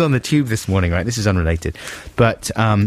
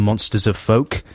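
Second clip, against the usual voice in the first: usual speaking voice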